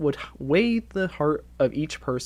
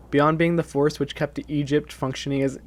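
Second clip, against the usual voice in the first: usual speaking voice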